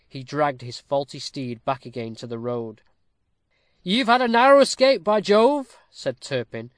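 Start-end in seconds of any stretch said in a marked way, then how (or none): 3.8-5.8 loud, high, astonished exclamation; thunderstruck. A narrator's reading. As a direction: burst out loudly, amazed at the near miss. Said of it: "who had been thunderstruck".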